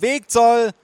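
put-on high, loud kid's command; small boy calling. A narrator's reading. Boy blocking grandmother, calling out. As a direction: shout it like a boy playing police. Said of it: "rief er".

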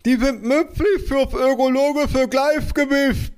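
slow, very high lisping wail; muffled mother. A narrator's reading. Mother defending hornets to father, high muffled lisp. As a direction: push words through a swollen mouth.

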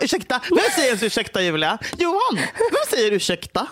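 can't believe anyone says that word; indignant outburst. Real, not acted.

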